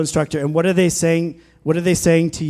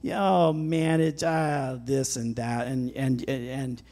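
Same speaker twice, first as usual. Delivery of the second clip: complaining mumble; 3 semitones lower, 6 dB quieter, slower than usual. Retold or imitated. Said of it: "the complaining in your soul".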